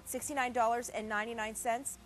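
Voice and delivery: ordinary delivery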